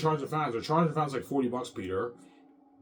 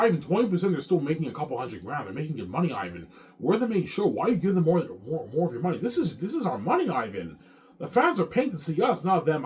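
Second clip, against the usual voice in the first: higher, louder mock-insistent partner; imitating greedy Peter; 6 semitones higher, 6 dB louder than usual. Narrator mimics business partner Peter haggling over money, raised and insistent. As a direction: push for a bigger cut, voice raised and pleading. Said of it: "Peter's like".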